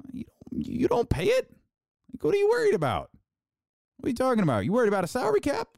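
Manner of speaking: high-pitched, incredulous questioning; exasperated disbelief. Real, not acted. Challenging fans worried about salary, exasperated and incredulous.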